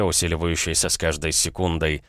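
ordinary delivery

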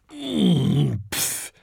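disgruntled dragon hum and puff; grumbling grunt. A narrator's reading. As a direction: grumble wordlessly, then huff. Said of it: "brummt der große Fressdrache". (missmutiges Brummen mit Schnauben)

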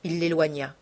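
ordinary delivery, nothing marked

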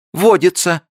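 very high, loud insistence; insistent declaration. A narrator's reading. Li insists to Abe, high and loud. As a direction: declare it stubbornly. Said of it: "заявила Ли".

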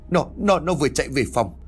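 high-pitched stumbling start on repeated words; frightened stammer. A narrator's reading. Shaken wife telling her husband, stammering. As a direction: stammer it out in acted alarm. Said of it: "rồi lắp bắp nói".

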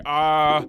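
a shaky startled cry; scared scientist's yelp; nervous yelp. Retold or imitated. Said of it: "shaking in his boots".

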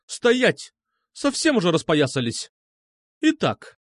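high, indignant commands; angry bark. A narrator's reading. Medusa scolds boys in her office, angry bark. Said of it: "зло рявкнула она".

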